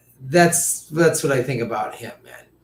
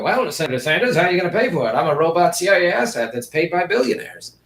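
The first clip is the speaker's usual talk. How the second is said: Pete impression; faster than usual. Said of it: "Pete would just turn and go".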